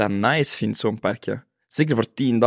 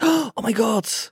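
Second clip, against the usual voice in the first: mock shock; 8 semitones higher, much slower than usual. Acting out seeing alligator.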